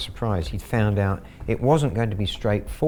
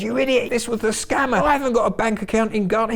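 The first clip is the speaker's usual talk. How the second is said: much higher, fast, furious outburst; mad scammer; 12 semitones higher, faster than usual. Retold or imitated. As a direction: rage at the idiot who lost your money. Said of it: "He went absolutely mad".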